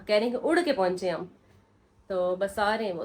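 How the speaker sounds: normal talking voice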